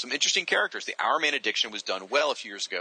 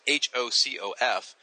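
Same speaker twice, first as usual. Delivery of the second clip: ordinary talk, nothing marked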